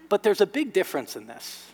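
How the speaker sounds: usual speaking voice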